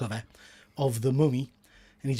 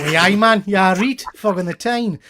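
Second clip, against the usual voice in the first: loud, hammed-up Geordie catchphrase; playful loud Newcastle accent; broad Geordie; 3 semitones higher, 7 dB louder than usual.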